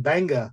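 "Bangor" ending in an open "ah"; Maine pronunciation. Retold or imitated. Coworker's old-timer example, "Bangor" said the Maine way as "Banga". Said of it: "where it's like".

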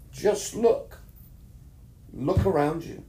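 a sudden lifted plea to open his eyes; inner voice calling. Retold or imitated. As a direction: let the voice rise as if from within. An inner voice speaks to him.

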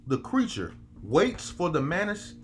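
slow, high, preacher cadence; preaching scripture. Acted. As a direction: read it like a sermon from the pulpit. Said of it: "This man said".

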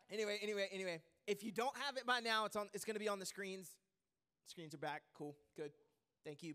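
ordinary talk

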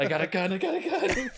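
high shouted warning; shouting. Acted. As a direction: yell it, high pitched. Acted raid.

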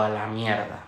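low, quiet deflated curse; retold crushed aside, soft and low; retold dejection. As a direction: imitate your own sinking reaction, voice dropping. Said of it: "Y me vine abajo".

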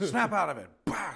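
put-on loud, high old-movie shout; hammed-up scolding. Old-film character slapping someone sane, hammed-up shout. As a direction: bark it with a mock slap noise. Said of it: "Smacks the shit".

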